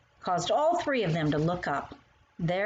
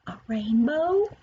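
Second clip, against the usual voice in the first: eager guess; 8 semitones higher, 5 dB louder, slower than usual. Pretend. Child guessing answer.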